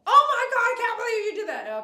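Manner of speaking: mock emotional shriek, pitched high and loud; overly emotional outburst. Shown as a demonstration. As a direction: ham up a hysterical overreaction. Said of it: "every time you speak your mind, it's emotional".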